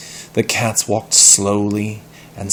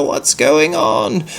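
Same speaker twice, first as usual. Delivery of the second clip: sleepy priest; 9 semitones higher, 4 dB louder, slower than usual. Acted.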